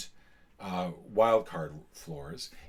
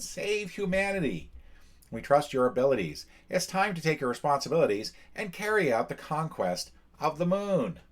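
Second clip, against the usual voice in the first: higher, louder, grand mission-briefing voice; dramatic briefing read; 6 semitones higher, 3 dB louder than usual. Acted. Reading the Human Alliance message to players, dramatic and raised. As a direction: sell the end of the world like a movie trailer.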